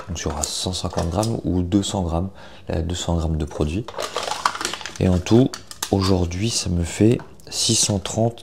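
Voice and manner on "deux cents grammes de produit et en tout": normal talking voice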